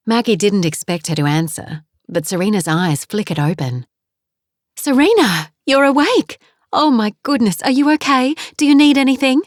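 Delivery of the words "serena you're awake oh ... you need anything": excited surprise. Acted. Daughter wakes unexpectedly.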